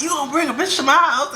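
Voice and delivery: high, indignant mock challenge; acted-out accusation; retold arguing. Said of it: "I'm arguing with Motivation".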